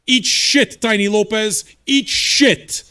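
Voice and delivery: loud, high-pitched angry dismissal; real angry outburst; aggressive. Real, not acted. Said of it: "I'm aggressive".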